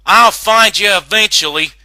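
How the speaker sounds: loud, high shout into an empty store; high, loud frustrated shouting voice. Acted. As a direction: call out angrily after the runaway. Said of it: "Applejack shouted into the store".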